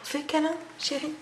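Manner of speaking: high, sweet coaxing pet voice; talking to a cat. Real, not acted. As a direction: coo at the cat gently.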